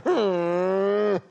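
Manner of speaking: a skeptical, sulky hum; disgusted hmm. Acted. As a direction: hum it like a wife who doesn't believe him. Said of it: "No le dijo ni una palabra, pero con ese gesto".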